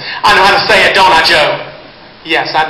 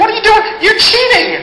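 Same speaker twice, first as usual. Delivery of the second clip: much higher, laughing mock outrage; mock accusation. Real, not acted. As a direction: catch them out playfully, laughing. Catches audience member whispering answer.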